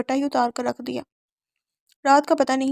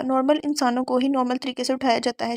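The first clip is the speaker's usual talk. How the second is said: plain reading voice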